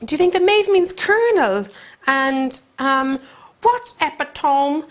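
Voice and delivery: high, snide mock schoolgirl, slow on what's epitome; smug schoolgirl. Rival girl mocking Maeve's reading, high and snide. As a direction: mimic a know-it-all rival mocking the word. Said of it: "made those two mistakes with the pronunciation".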